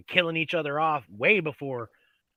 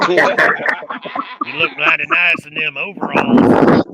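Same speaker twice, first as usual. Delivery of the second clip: mock trucker flirt; 4 semitones higher, 8 dB louder than usual. Mocking the trucker hitting on Brandon, loud leering voice.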